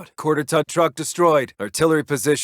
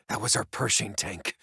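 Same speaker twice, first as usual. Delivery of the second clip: much softer and lower, taking the loss in; stunned, quiet loss; 6 semitones lower, 9 dB quieter than usual. Acted. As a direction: let the words sink in. Reporting a lost tank.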